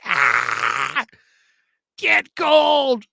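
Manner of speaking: high, loud panicked-fan yell; mock screaming. Host acting out fans' reaction to missed kicks, mock screaming. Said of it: "I would be screaming".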